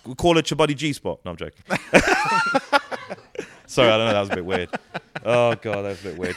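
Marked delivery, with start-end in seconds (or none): 1.7-3.4 high burst of laughter. Real, not acted. Comedian after his cheeky joke, high laughing fit. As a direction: crack up at your own joke.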